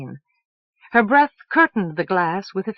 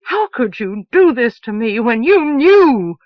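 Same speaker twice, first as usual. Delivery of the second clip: loud furious accusation; 4 semitones higher, 9 dB louder than usual. Acted. Moon to Elsevier who knew: loud furious accusation. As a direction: hurl the question at her. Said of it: "turning furiously".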